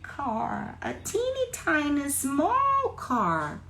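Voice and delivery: high, drawn-out squeak for smallness; slow, high, itty-bitty voice; thinned tiny voice. Shown as a demonstration. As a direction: shrink the voice to sound teeny tiny. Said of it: "a minha voz se afinou".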